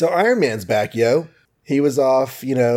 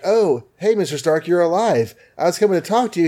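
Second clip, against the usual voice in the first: much higher, eager underling greeting; 7 semitones higher than usual. Retold or imitated. Host voicing [name] greeting [name], high and eager. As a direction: gush like an employee finally catching the boss.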